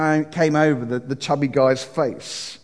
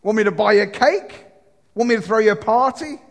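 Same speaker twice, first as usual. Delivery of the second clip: higher, mocking snap at her friend; nasty tone; 7 semitones higher than usual. Prostitute sneers at her friend about her birthday, nasty mocking tone. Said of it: "in a nasty tone and said".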